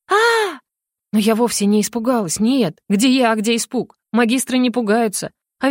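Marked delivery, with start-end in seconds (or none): 0.1-0.6 high short frightened scream; a sudden terrified shriek; panicked scream. A narrator's reading. Heroine screaming at the rat, high short scream.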